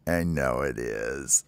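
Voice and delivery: low, soft, slow confession; sheepish admission. Acted. Baby bird admits the stink to the counselor, low and sheepish. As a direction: own up quietly and sheepishly.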